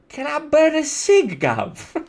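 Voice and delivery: high-pitched British cockney caricature; mock British accent. Asking a gov for a cig, mock British accent. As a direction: put on a cheeky British accent. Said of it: "that's how they say it".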